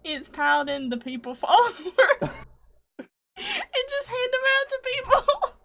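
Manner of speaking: laughing through the words, pitch climbing high; loud, high, giggly delivery; cracking up. Real, not acted. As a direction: barely get the pitch out for laughing. Cracking up while pitching the business card.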